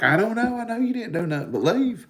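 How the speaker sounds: high, quick put-on voice of the friend; friend's voice. Friend reassuring him he did nothing, in a high fast voice. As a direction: quote her quick and high, shrugging it off. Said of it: "And she's like".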